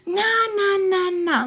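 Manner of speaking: high mock stepdad nah; mock mad stepdad. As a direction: imitate him waving her off. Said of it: "getting all mad".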